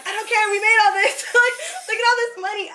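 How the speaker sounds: high, fast giddy laughter; giddy excitement. Retold or imitated. To the angry client, giddy and high over the cash. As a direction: gloat gleefully over the cash. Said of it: "And I was like".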